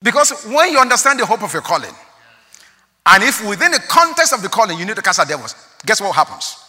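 preacher rousing the congregation; preaching at full force. Acted. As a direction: build toward the reveal.

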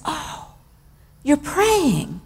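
softly voiced little boy, slightly high; imitated child backing off quietly; hushed child's realization. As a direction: drop to a small, hushed voice. Child realizes mom is praying.

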